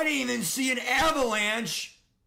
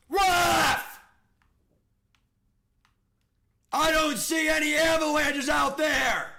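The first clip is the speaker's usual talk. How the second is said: raised, indignant shout at the referee; yelling at the ref; 4 semitones higher, 5 dB louder than usual. Real, not acted. Gamer yells at the referee over a fumble, indignant shout. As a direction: call the ref out, furious.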